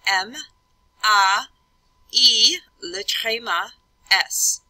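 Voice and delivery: French letter names, loud and separated; emphatic letter-by-letter French dictation; French spelling aloud. Shown as a demonstration. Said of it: "I'd have".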